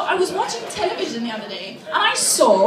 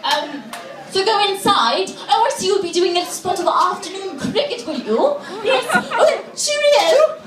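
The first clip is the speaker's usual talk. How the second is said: rambling, over-polite posh British; snotty British guy. Acted. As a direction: dither like a snooty English gentleman. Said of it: "snotty-nosed British guy who would go".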